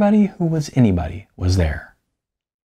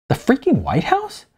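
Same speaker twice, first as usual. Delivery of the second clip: sharply higher, disbelieving question; incredulous outburst; 12 semitones higher than usual. Acted. Samantha, stunned by his confession, incredulous. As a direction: throw the question back at him in shock.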